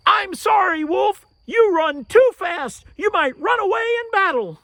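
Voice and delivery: high, loud shout across the distance; shouted excuse. Acted. Turtle shouts to the far-off wolf, high loud shout. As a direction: yell the excuse after him.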